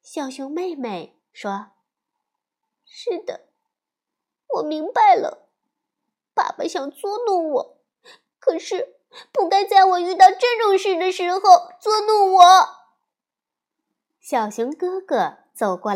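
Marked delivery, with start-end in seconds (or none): 2.9-12.4 high, rising indignation, louder at the end; a hurt child complaining about dad's trick; upset little girl bear. Acted. 小熊妹妹委屈地抱怨爸爸，高声激动.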